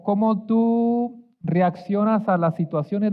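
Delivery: ordinary delivery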